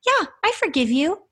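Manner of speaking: put-on high, small child voice; little Aussie boy. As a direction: imitate a three-year-old Australian son, pitched right up. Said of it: "he, three-year-old".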